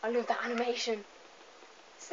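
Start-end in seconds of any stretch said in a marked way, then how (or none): none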